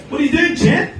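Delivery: high chirpy cop voice. Acted. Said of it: "And he said".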